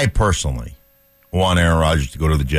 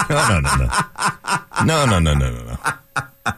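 laughing; 3 semitones higher than usual. Real, not acted. Laughs off joke.

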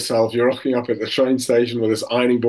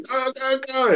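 high, held-back crowd chant; imitated cheering; 13 semitones higher than usual. Everyone cheering on stretcher racers, high mock cheer. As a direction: mimic cheering supporters at reduced volume. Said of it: "everyone's cheering".

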